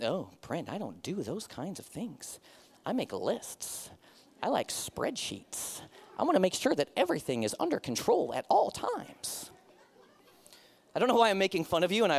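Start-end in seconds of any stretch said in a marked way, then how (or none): 0.0-9.5 lightly voiced timid list-maker, softer; mock timid planner. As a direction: tease the cautious types softly. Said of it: "some of you are like".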